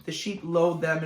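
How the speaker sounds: ordinary delivery, nothing marked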